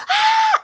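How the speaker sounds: short, high mock scream; imitated scream. Storyteller imitating the frightened lady on the tour, screaming. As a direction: let out a loud startled shriek, imitating her. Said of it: "she screams and she's like".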